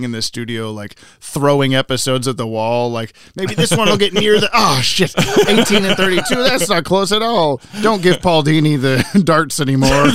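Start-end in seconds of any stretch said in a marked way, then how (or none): 3.4-10.1 high, loud acted dismay through laughter; hammed-up frustrated outburst, laughing; mock dismay. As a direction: play an exasperated thrower missing the mark. Voices studio staff throwing episodes.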